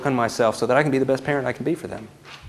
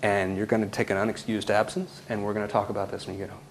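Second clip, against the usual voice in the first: softer, level, unhurried firmness; clear and calm; 5 semitones lower, 8 dB quieter than usual. Retold or imitated. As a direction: keep it calm and firm, no heat. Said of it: "she just clearly and calmly says".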